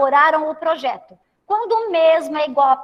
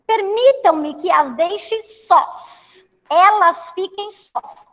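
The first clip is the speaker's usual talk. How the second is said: slow teaching model; slower than usual. Shown as a demonstration. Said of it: "teacher modelling phrase slowly".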